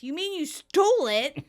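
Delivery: high, loud accusing question; mock yell. Phoebe accuses Monica of stealing her cold, mock yelling. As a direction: raise it into an imitated yell. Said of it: "Phoebe yells".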